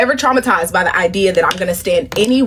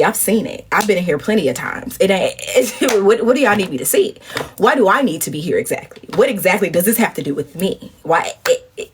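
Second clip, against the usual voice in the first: ordinary talk, nothing marked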